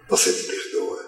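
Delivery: hushed plea for forgiveness; father whispering. Retold or imitated. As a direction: ask it in a faint whisper. (pai pedindo perdão em sussurro)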